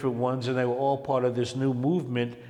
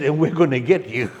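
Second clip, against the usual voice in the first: laughing threat; 6 dB louder than usual. Retold or imitated. Quote with laugh.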